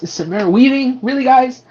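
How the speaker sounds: high-pitched exasperated protest. Real, not acted. Film fan complaining to the filmmakers about a casting, exasperated. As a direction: protest in rising disbelief. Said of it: "I'm so mad 'cause".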